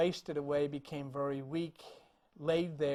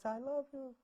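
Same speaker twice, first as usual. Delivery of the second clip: higher, soft repeated words; soft echo; 7 semitones higher, 7 dB quieter than usual. Acted. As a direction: echo it back softly and tenderly. Echo repeats his last words.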